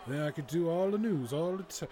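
usual speaking voice

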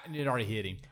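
usual speaking voice

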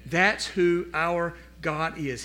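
ordinary talk, nothing marked

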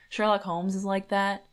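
ordinary talk, nothing marked

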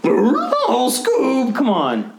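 lightly voiced Shaggy; a hint of Shaggy calling Scoob. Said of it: "Trying to do Shaggy".

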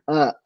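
hacking cough; chesty cough. Real, not acted. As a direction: cough from a throat tickle. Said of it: "I can't stop coughing".

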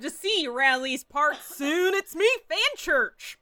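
put-on a light Texan cowboy accent. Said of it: "a really thick Texan accent".

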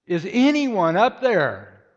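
loud, high, drawn-out call upward; desperate yell. Acted. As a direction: call slowly and desperately to someone far above. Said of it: "in desperation he yelled".

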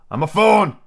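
high, loud burst of delight; delighted cry. A narrator's reading. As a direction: cry it out with joyful discovery. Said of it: "cried out in a voice of delight".

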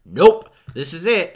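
loud, slow, emphatic nope; emphatic dismissal. Retold or imitated. Mock verdict after looking up director.

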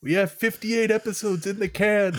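high, drawn-out mock voice; mock Brazilian podcasters. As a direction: put on a high, slow impression of foreign podcasters. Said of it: "The Brazilian Podflixers are like".

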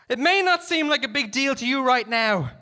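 high, loud, reasoning shout; calling out. A narrator's reading. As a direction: reason with him at the top of your voice. Negotiator calls out to gunman.

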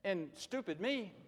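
normal talking voice

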